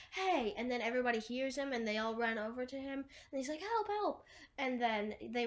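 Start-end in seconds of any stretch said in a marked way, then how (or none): 0.1-0.5 higher-pitched mock shout. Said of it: "Thomas shouts". 3.6-4.2 high mock cry for help. Thomas calls out for help.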